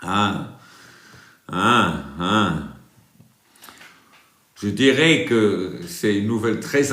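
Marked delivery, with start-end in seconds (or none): none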